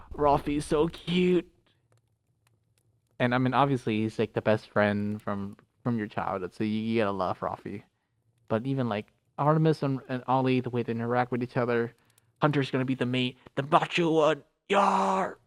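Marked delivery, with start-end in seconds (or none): 0.1-1.5 slow, drawn-out fawning over someone cute; playful gushing. Pretend. Gushes over a cute character. 13.6-15.4 playful macho growl ending in a higher cry; mock macho. Mimics the macho character.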